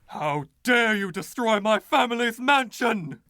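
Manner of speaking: high, outraged cry of a wronged aristocrat. Acted. Ghost accusing the new tenant over his mansion, outraged. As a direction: let the indignation burst out.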